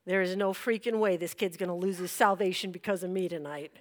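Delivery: retold silent vow; inner resolve. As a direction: say it under the voice, firmly decided.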